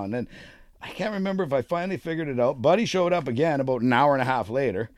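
normal talking voice

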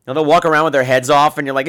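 ordinary talk

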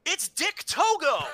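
high-pitched, drawn-out startled announcement; sudden excited outburst. Retold or imitated. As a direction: imitate a commentator blurting out a surprise. Acting out Alvarez's sudden live reaction.

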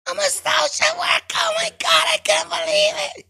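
very high, loud starstruck woman; squealing female caller. Retold or imitated. Caller squealing at the host about her job. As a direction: shriek it like a thrilled fan. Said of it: "caller answers the host".

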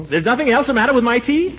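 high, delighted disbelief; overjoyed it's nothing; amazed relief. Acted.

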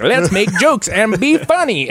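high, slow, playful self-parody; lightly hammed-up silly eagerness; mock goofy enthusiasm. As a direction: play yourself as the carefree joker. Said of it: "I'm just like".

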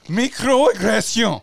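Microaggression in French, slow, vowels stretched; slow mock-French reading of Microaggression; French pronunciation. Shown as a demonstration. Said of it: "That's it".

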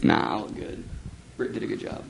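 soft, nearly whispered defense; muttered aside. Real, not acted. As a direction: mumble it under your breath.

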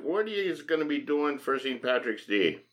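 ordinary talk, nothing marked

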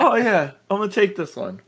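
casual character voice. Retold or imitated. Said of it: "the guy's like".

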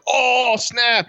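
high, drawn-out mock amazement; mock amazed reaction. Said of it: "as opposed to like".

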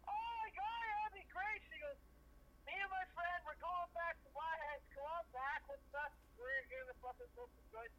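imitating the drunk waitress, pitched well up; high, giddy drunk waitress. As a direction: squeal it out like a tipsy, thrilled waitress. Drunk waitress voiced in story.

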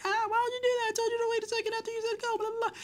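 high-pitched mock wailing complaint; squeaky fake bawling, racing through the words; mock crying. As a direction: imitate a crying kid, rushed and very high. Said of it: "fucking Sawyer's crying".

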